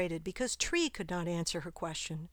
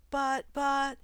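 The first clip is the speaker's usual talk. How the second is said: stammer; 7 semitones higher, 4 dB louder than usual. Acted. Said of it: "stammered the girl".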